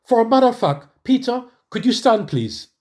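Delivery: mimicking the preacher's announcement; imitating the pastor. Preacher calls on Peter to stand, mock pulpit voice. Pastor calling him out publicly.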